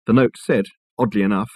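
plain reading voice

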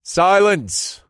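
loud, high, sharp command; commanding order. A narrator's reading. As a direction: cut them off with a loud order. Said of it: "I ordered".

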